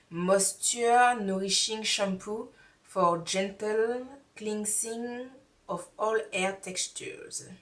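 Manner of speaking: slow, halting English with a put-on heavy French accent; mock French accent. Reading the English shampoo label to viewers, in a mock heavy French accent, slowly. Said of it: "je fais comme si mon accent n'est pas au top".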